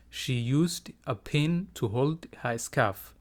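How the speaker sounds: words joined up, still slow and careful; slow teacher's model without long gaps; whole sentence for repeating. Shown as a demonstration. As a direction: read it as one connected, measured sentence. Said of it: "Iga daba dheh".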